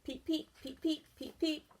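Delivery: high soft peeping; frog peeps. Acted. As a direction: chirp like a tiny spring frog. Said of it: "the sound surrounded them".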